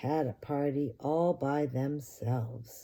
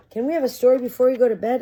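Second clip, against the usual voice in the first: put-on high, loud, fast child plea; high excited kid voice; eager child; 8 semitones higher, 10 dB louder, much faster than usual. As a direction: beg dad like an excited kid. Child begs father.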